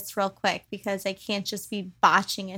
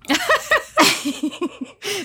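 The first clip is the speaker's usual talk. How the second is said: high burst of laughter; 12 semitones higher than usual. Real, not acted. Laughing at her own joke about the ghost, high laugh. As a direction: crack up laughing.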